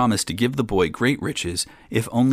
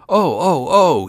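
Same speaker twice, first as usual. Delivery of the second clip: loud, high shocked yelps; crying out; 10 semitones higher, 8 dB louder, slower than usual. A narrator's reading. Young king drenched with cold water and fish, crying out. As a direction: yelp as if doused with cold water. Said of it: "he cried".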